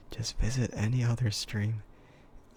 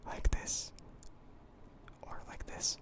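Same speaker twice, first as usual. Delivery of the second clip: breathy full whisper, dropping softer and softer; whisper demo; 10 dB quieter than usual. Shown as a demonstration. Streamer demos the old whisper for chat, fully whispered. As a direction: show the viewer the intense whisper. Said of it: "the whisper that was going like this".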